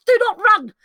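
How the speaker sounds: high, loud cry of warning; urgent plea. Acted. Conscience begs Lancelot to stay, loud and high. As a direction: call out to stop him running.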